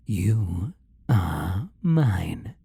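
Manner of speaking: very slow, word by word; possessive claim. Acted. Demon claiming the captive puppy, slow and possessive. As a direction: draw out each word to stake ownership.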